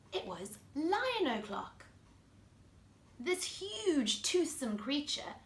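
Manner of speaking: put-on lively storybook voice for kids; reading to children. A narrator's reading. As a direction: read it like bedtime story time. Reads picture book to children.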